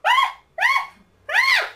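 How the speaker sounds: repeated short high shrieks; three loud yelps of mock fright; acted frightened screams. Acting fright at knife.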